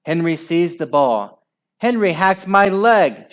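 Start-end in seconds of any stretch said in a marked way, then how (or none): 1.8-3.3 high, loud outraged complaint; shriek. A narrator's reading. Margaret tripped by Henry, shrieking. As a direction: shriek the accusation. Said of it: "shrieked Margaret".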